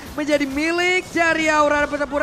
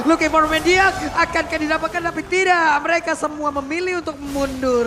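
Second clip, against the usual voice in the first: fast, rising shout-casting, louder and higher; live play-by-play; 5 semitones higher, 6 dB louder than usual. Real, not acted. Caster calling the teamfight to viewers, excited play-by-play. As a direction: ride the teamfight call with mounting hype.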